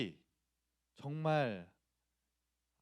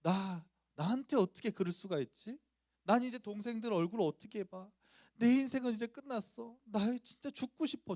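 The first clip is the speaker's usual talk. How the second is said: put-on high-pitched, distraught child lamenting; acted schoolboy anguish; wounded despair; 7 semitones higher than usual. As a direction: wail it with hurt pride, building higher. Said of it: "너무 제가 자존심이 상해서".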